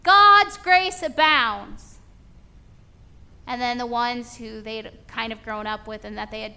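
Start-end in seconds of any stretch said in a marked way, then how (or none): 0.0-2.0 loud, high, thrilled praise; excited convert. Retold or imitated. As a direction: gush it out like a new believer on fire. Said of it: "they're like".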